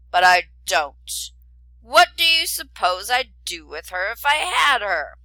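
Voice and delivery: loud, slow rumbling dragon voice; an indignant beast refusing a rigged fight; grumpy dragon. A narrator's reading. Dragon refuses to fight for a princess, loud rumbling growl. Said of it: "growled the dragon in a huge rumbling voice".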